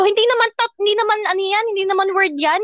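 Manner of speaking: high-pitched retold objection; arguing with mother. Daughter disputing "ta" with mother, arguing. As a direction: object insistently, pitched well up. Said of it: "nag-away pa kami ng nanay ko".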